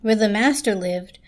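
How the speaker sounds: ordinary delivery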